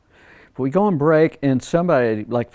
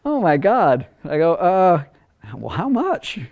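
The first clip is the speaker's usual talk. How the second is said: taken aback; 6 semitones higher, 3 dB louder, slower than usual. Retold or imitated. Taken aback, asks the price.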